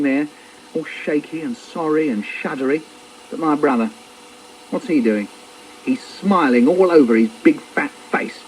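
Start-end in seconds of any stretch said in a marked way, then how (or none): none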